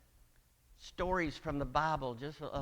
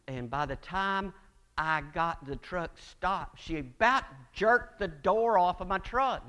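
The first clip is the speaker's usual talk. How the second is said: normal talking voice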